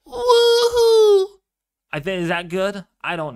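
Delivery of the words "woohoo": very high, loud squealed woohoo; falsetto girly woohoo. Pretend. Streamer squealing woohoo in falsetto for chat. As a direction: shriek it up in a put-on girl's falsetto. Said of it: "I don't have a girl's voice".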